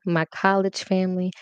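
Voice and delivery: ordinary delivery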